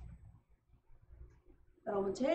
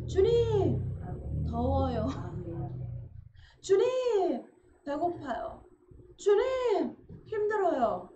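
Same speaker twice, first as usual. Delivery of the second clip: calling on the Lord; 5 semitones higher, much slower than usual. Shown as a demonstration. Believer crying to the Lord in hardship, urgent pleading. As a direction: call out the Lord then plead. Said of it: "앞에 주님을 먼저 불러보세요".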